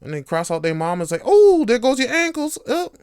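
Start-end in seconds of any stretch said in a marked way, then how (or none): 1.2-2.9 put-on loud, high kid taunting; trash talking kid. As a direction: shout the taunt like a cocky kid. Child trash talking on court.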